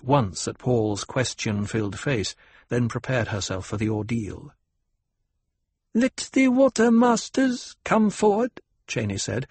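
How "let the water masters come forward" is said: put-on high young girl with a slight tremble; a girl priestess barely hiding nerves; girl-child voice, slight quaver. A narrator's reading. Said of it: "slightest quaver of uncertainty in her girl-child voice".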